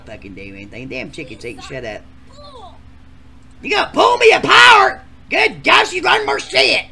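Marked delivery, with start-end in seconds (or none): 3.6-6.9 high, loud outraged shouting; loud outrage. Acted. Contestant yelling at the granny, outraged shout. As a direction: yell in disbelief.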